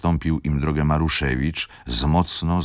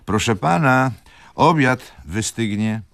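raised voice, higher and louder, to wake him; calling out; 7 semitones higher, 4 dB louder than usual. A narrator's reading. Servant calls his dozing master to lunch, raised voice. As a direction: call out to the sleeping master. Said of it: "zawołał".

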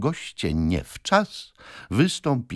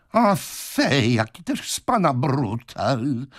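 high, drawn-out reproach; sympathetic landlady's cry; 7 semitones higher, slower than usual. A narrator's reading. Landlady calls him a brute, sympathetic exclamation. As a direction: tut at him in a raised voice. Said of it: "zawołała ze współczuciem właścicielka hotelu".

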